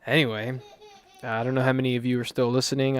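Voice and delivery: ordinary delivery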